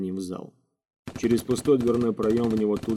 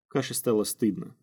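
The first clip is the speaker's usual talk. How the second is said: ordinary delivery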